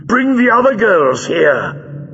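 high, drawn-out villain command; barked order. A narrator's reading. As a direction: call out imperiously to the guards. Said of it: "ordered Greel".

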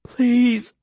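plain reading voice

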